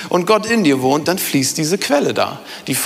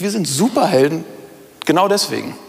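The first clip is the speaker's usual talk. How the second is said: normal talking voice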